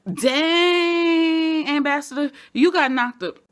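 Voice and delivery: loud, high whoop at the knockout; gleeful outburst. Real, not acted. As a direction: shout it like a fan who just saw a punch land. Reacting to the punch.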